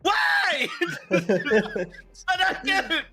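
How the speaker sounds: high, slow laughing whine. Real, not acted. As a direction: laugh through the words. Laughing at sub crash.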